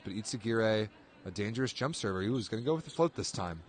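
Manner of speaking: normal talking voice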